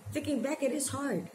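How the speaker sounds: high-pitched, real upset on the edge of crying; fighting back tears. Real, not acted. Daughter asking her late father why, fighting back tears. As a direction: plead through held-back tears, pitched well up. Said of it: "So I don't start crying".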